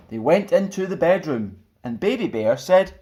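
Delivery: lively, sing-song storybook reading; children's story narration. A narrator's reading. Stuart reading a picture book to children, lively. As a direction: read it like bedtime storytime for kids.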